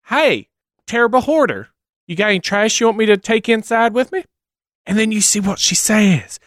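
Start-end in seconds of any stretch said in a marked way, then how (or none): 0.1-4.2 mock cheerful offer. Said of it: "And you say".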